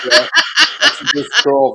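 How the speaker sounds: words spilling out through a laugh. Real, not acted. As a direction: laugh while you say it.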